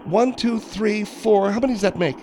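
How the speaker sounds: put-on high voice, indignant; exasperated Margaret. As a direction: play a fed-up woman counting heads. Margaret character in comedy bit.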